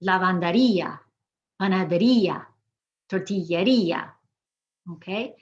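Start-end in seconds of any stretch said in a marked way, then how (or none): 0.0-4.1 "Lavanderia, panaderia, tortilleria" each ending in a stressed REE-a; strong I. Shown as a demonstration. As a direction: punch the I in "Lavanderia, panaderia, tortilleria" as its own syllable. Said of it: "we've made the I strong".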